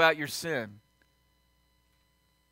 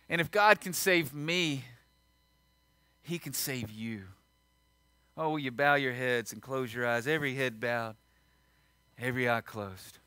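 hushed altar call; 4 semitones lower, 7 dB quieter than usual. Acted. Pastor inviting the bowed congregation to Jesus, hushed and tender. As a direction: draw them in quietly with heads bowed.

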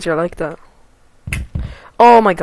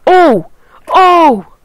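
high startled yelps; shriek; 9 semitones higher, 9 dB louder than usual. Real, not acted. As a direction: cry out high and loud. Reacting to falling.

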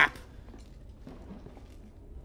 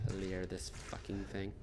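ordinary talk, nothing marked

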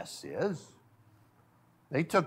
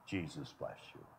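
gentle blessing; 12 semitones lower, 19 dB quieter than usual. Retold or imitated. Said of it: "every child he would say".

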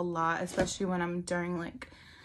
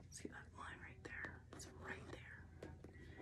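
hushed whisper pointing something out; breathy aside, barely any voice; 24 dB quieter, slower than usual. Real, not acted. Whispering to viewers about the line. Showing a tiny detail on camera.

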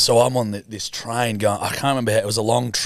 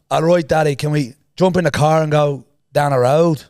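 a light southern Irish accent, louder; 4 semitones higher, 5 dB louder than usual. Shown as a demonstration. Teller demonstrates a southern Irish accent to listeners. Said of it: "if I was from the south of Ireland, I'd say".

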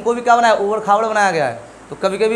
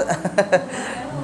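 quiet breathy chuckle; amused little laugh, mostly breath; short laugh; 2 semitones lower, 7 dB quieter than usual. Real, not acted. Teacher reacting to a student's answer, short breathy laugh.

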